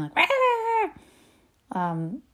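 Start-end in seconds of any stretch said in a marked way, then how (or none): 0.2-0.9 very high screechy roar; hammed-up wordless shriek; mock scream. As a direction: screech like a crazy mum. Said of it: "always screaming".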